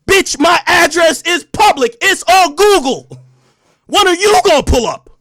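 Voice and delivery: mocking a rival's online threat with a loud slow yell; mock shout. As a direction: belt the taunt out slow and high. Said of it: "I came on with a lot of energy".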